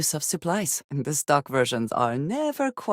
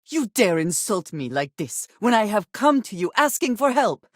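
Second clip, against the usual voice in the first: furious outburst; 6 semitones higher, 4 dB louder than usual. Acted. Enraged NPC threatens the player.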